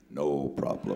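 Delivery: low, unruffled brush-off; calm fixer, pitch dropped way down; cool reassurance. Retold or imitated. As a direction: say it deep and totally unbothered. Herbie unbothered amid the tears.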